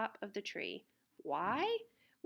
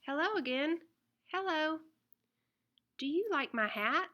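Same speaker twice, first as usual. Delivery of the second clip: higher, lively little lady dog asking about her hat; perky, sing-song girl dog, a touch faster at the end; 6 semitones higher, 3 dB louder, faster than usual. Acted. Girl dog greets again.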